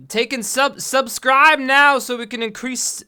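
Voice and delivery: high, slow hammy sales pitch; mock ad plug. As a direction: plug it like a cheesy ad.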